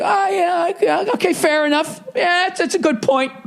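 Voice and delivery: high, squeaky-pitched mock concession; mock sudden agreement. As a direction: act out a screamer suddenly giving in. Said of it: "stop mid-screaming and be like".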